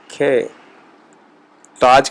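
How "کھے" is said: کھے said with a puff of aspirated kh. Shown as a demonstration. Teacher showing Persian kaf as aspirated kh. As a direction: breathe out on کھے. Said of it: "کے کو بولیں گے کھے".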